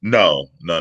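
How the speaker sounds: ordinary talk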